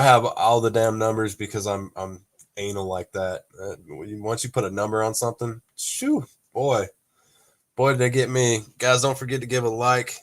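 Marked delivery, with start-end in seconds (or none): none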